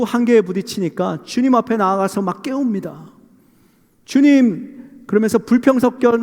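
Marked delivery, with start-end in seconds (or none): 4.0-5.0 high, drawn-out call to the Lord; imploring, aggrieved cry; complaining call. Acted. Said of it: "불평을 섞어가면서 막 주님께 따집니다".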